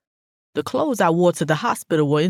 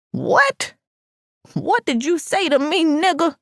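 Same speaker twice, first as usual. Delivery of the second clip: high-pitched, incredulous challenge; indignant, pitch shooting way up; outraged; 11 semitones higher than usual. A narrator's reading. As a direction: fire back in disbelief at an insult. Passenger confronts driver who insulted her.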